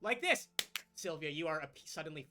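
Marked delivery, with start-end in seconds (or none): 0.0-0.5 louder, higher gleeful boast; gleeful show-off. Acted. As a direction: delight in showing off your magic. Archfey shows off magic.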